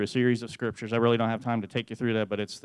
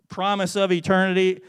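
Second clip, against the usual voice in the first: normal talking voice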